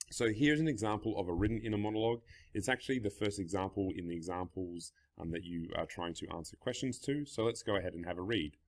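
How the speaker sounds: ordinary talk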